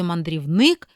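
«мандрівник» said with the last syllable НИК stronger and longer; «мандрівни́к» with stress on the last syllable; stress on third syllable. Shown as a demonstration. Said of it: "третій".

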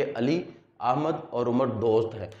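short stop after Ali, then Ahmad और उमर दोस्त हैं runs on; brief comma pause. Shown as a demonstration. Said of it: "ये अलामत सख्ता की वहाँ लगेगी". (अली पर ज़रा सा रुककर आगे पढ़ें)

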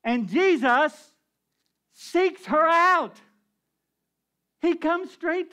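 slow, loud high proclamation; slow proclaimed climax. Real, not acted. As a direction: proclaim it, stretching every word.

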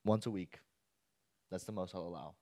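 stern dad. Acted.

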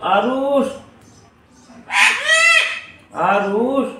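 loud, high call across the house. Real, not acted. Mother calling the boy Aarush back home, loud high call. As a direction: shout his name to bring him back.

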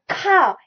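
"ka" with an overdone, puffy K; too-strong K. Shown as a demonstration. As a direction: push the K hard in "ka". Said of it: "it is too strong".

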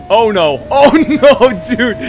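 laughing loud dismay. Real, not acted. Losing the race, loud laughing groan. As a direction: laugh it out as you lose.

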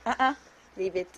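low, firm dog command; stern command. Real, not acted. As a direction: drop the voice and command.

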